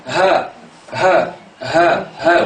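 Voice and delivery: breathy ha from the throat; ha sound. Shown as a demonstration. Teacher contrasting ha with A for the class, breathy throat sound. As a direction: push the ha out on the breath. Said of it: "On dit pas A et ha n'importe comment".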